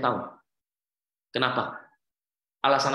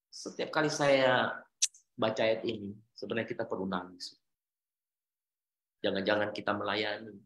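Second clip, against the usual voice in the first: usual speaking voice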